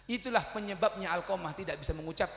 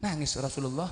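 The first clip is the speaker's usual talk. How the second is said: usual speaking voice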